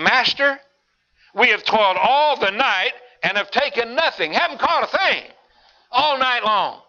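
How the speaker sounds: high, pleading complaint from a tired fisherman; exasperated fisherman. Acted. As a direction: whine to the Lord that the night was wasted. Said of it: "Simon said unto him".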